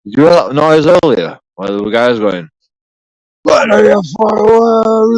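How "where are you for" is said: drawn-out, much higher dopey yell; lunkhead voice. Retold or imitated. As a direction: stretch it out like a dim hard man shouting in the street. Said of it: "That's the lunkhead, lunkhead language".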